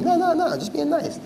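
very high, put-on denial; imitating a schemer playing nice; mock innocent protest. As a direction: protest your innocence in a squeaky voice. Imitates people who used to game him.

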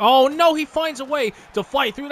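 sudden high, loud dismay; alarmed outburst. Real, not acted. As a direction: yelp as he slips through. Opponent breaks through.

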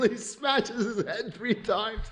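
ordinary delivery, nothing marked

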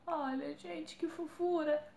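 high-pitched melting at cuteness; adoring coo. Real, not acted. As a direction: coo over something adorably cute. Cooing over a cute product.

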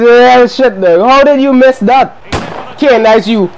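normal talking voice

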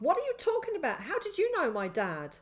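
high, loud, urgent questions; shouting. A narrator's reading. Boy confronts stranger about his dad, shouting. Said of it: "I shouted, pushing him off".

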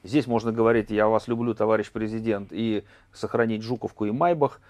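ordinary talk

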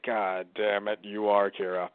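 low, weakened voice; dying realisation. Acted. L dying as he sees Light's face, low and defeated. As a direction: drop low, as if with the last strength.

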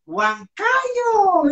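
high, sung-out Huancayo; mock announcer call. Host imitating guest's radio announcement, high and sung.

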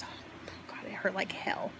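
whispered, remembering the pain. Real, not acted. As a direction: breathe it out in a whisper. Recalling painful drain removal.

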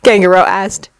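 usual speaking voice

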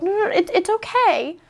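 high, choked-up voice breaking into a laugh; fighting back real tears while talking; on the verge of crying, pitch climbing; close to tears. Real, not acted.

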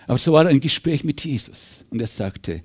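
normal talking voice

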